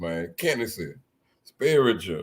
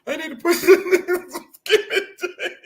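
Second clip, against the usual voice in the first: high laughing voice; words fighting through laughter; 3 dB louder than usual. Real, not acted. Cracking up over the skinny jeans joke.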